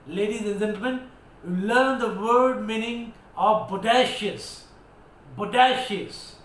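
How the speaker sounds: loud, heavy stress on "bodacious"; emphatic. Shown as a demonstration. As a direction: address the audience emphatically, punching "bodacious" hard. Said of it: "I am being quite emphatic".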